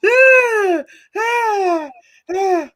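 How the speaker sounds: loud high shriek of laughter. Real, not acted.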